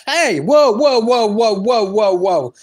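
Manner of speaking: high, loud burst of whoas, gradually falling in pitch; protesting interjection. Real, not acted. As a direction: cut in loudly, starting high and stepping down. Cutting in to object about Aldi.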